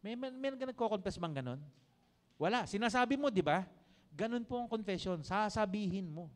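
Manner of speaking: ordinary talk, nothing marked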